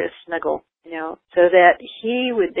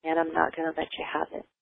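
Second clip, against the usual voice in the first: gentle calm firmness; 8 dB quieter than usual. Shown as a demonstration. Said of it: "you just go".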